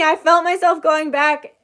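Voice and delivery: ordinary delivery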